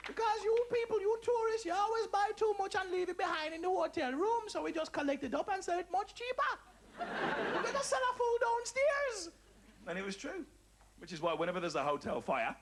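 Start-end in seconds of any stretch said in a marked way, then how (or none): 0.1-9.3 put-on high Jamaican accent, scolding then selling; a much higher scolding Jamaican lady; Jamaican cleaning lady. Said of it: "old Jamaican cleaning lady".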